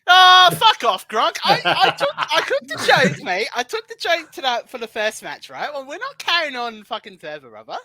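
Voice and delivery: playful fury at a mate teasing you; mock outrage. Real, not acted. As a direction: bellow at your mate in fake offence. Mock outrage at friend's joke.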